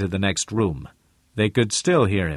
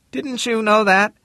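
raised higher voice; a sharp man asking; Harmon voice; 14 semitones higher, 4 dB louder than usual. Acted.

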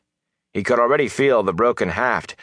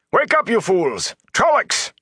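high, urgent yelled warning; strained, high-pitched cry of alarm; alarm shout; 12 semitones higher than usual. A narrator's reading. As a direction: rouse the crew with a yell. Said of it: "he shouted".

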